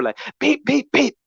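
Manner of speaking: high hardcore horn beeps. Retold or imitated. Driver imitating the hardcore honking, high beeps. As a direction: honk it out high and sharp.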